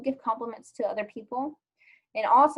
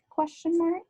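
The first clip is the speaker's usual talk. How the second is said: high-pitched, tentative aside; playful questioning; 8 semitones higher than usual. Real, not acted. Teacher asking students about returning compliments, playfully questioning.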